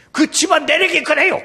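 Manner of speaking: high, loud imitated outburst; indignant mother-in-law. Mother-in-law complaining about her son-in-law, indignant mimicry. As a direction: blurt it out as an upset mother-in-law. 장모님이 막 그렇게 하고 있어요.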